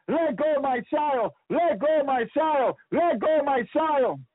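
high, slow pleading cry of a mother; desperate mother. Retold or imitated. Mother in the tribulation begging a demon, high slow pleading. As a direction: plead slowly with the demon for your child. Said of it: "was saying to the demon".

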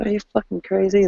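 high, quick, incredulous voice; incredulous onlooker. Acted. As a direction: mimic someone baffled by you. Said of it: "people will look at you and go like".

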